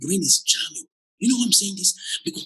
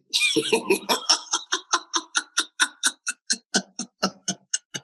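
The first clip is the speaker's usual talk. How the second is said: high laugh. Real, not acted. Laughs after "be caught smiling.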